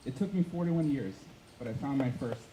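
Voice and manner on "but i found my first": ordinary delivery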